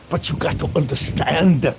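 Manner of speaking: a mock German accent; playful German-accent impression. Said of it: "I sound like Werner Klinker".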